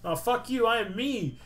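high-pitched mock defiance; mimicked fired-up character. Player mimicking transformed Taka, mock defiance. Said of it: "and he's like".

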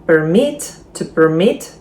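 to perMIT, the verb, stressed on the last syllable; second-syllable stress. Shown as a demonstration. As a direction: lean on MIT in permit. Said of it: "наголос на другий склад".

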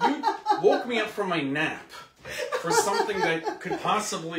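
loud, high incredulous complaint; exasperated outrage. Real, not acted. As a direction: protest in disbelief at being woken for nothing. Mock outrage over expired ingredient.

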